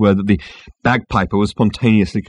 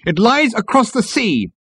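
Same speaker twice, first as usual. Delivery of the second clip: slow, high military report; significant announcement; 13 semitones higher than usual. A narrator's reading. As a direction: stretch it out with portent. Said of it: "narrowing his eyes significantly".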